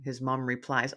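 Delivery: usual speaking voice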